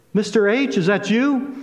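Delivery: high, startled question; as if seeing a familiar face unexpectedly; surprised recognition. Retold or imitated. Old student recognizing his teacher.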